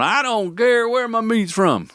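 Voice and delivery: high mock drunk hillbilly accent. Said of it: "you talk hillbilly".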